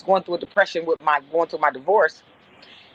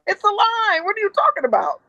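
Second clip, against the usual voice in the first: high, exasperated comeback; mad retort; 13 semitones higher than usual. Retold or imitated. Supervisor arguing with her boss about the line, retold mad retort. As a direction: argue back indignantly, pitched right up. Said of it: "I was so fucking mad".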